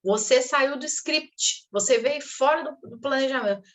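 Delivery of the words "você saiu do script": ordinary talk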